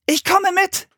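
put-on very high child's voice; decide on the spot, eagerly; high, resolute little girl; determined girl. A narrator's reading. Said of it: "beschloss Anne".

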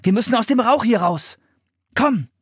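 high-pitched, urgent command. A narrator's reading. Philipp leading the way out of smoke, urgent. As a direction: push them to get out. Boy urging escape from smoke.

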